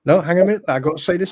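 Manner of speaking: ordinary delivery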